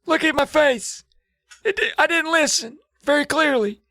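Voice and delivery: put-on high, strained old man voice. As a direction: croak it out like a frail old man. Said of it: "He's like".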